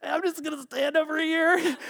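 high, laughing timid aside; nervous laughing brother. Retold or imitated. Brother edging away from Joseph, laughing. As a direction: laugh it out while backing off.

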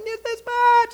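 very high squealed exclamation; playful mock yell. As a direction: squeal it gleefully in a game with your daughter. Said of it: "We start yelling".

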